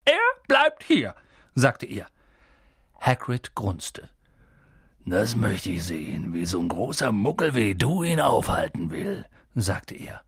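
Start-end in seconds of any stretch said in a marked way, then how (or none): 0.0-1.1 much higher, louder and slow; angry uncle. A narrator's reading. Uncle Vernon angrily forbids Harry to leave. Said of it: "doch sehr zornig aussehend". 5.0-9.2 lower, mocking giant's voice; scornful Hagrid. A narrator's reading. Hagrid scoffs at Vernon, lower and mocking.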